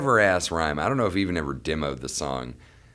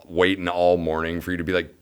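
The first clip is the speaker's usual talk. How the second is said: usual speaking voice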